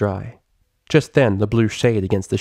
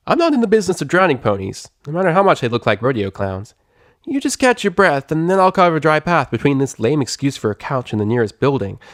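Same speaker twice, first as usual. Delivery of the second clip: higher, cocky tomboy pegasus voice; Rainbow Dash, brash; 6 semitones higher than usual. Acted. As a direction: tease her like a sporty show-off.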